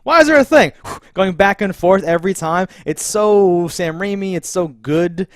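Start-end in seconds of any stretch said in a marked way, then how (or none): 0.0-0.7 high, loud, baffled question; mock baffled character. Mocking a baffled character's question, high and loud. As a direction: squeak it out in disbelief.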